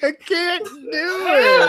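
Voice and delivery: high, slow, cracking mock cry; mock wail. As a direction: wail it like a failure. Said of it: "He's like".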